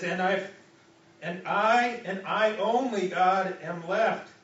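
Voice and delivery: loud, slow, anguished protest; desperate plea. Acted. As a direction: cry it out like a cornered prophet. Elijah pleading, voiced by preacher.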